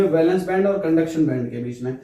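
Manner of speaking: normal talking voice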